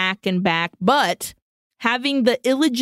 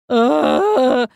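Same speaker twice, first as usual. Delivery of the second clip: disgusted groan; 3 semitones higher, 5 dB louder than usual. Real, not acted.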